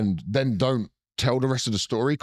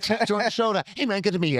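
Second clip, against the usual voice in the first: a light Tarantino voice, pitched up; a breezy Tarantino hello; a casual Tarantino imitation, slightly pitched up; 9 semitones higher, faster than usual. Acting Tarantino greeting.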